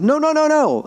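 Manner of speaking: high, rapid-fire protest; hasty denial. Retold or imitated. Setting the Glasgow singer straight, high hasty denial. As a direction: deny it in a hurry. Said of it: "And I said".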